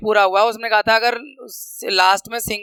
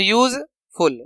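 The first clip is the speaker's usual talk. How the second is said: Useful with a gap before फुल; broken into syllables; much slower than usual. Shown as a demonstration. Teacher counting two syllables, useful broken in two. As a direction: split useful into यूज and फुल. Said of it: "दो syllable का word है".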